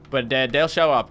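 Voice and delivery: normal talking voice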